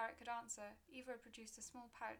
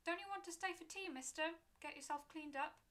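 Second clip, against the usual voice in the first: higher, louder calling after someone; 7 semitones higher, 6 dB louder than usual. Acted. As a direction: call out to a departing rider. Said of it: "The keeper called out".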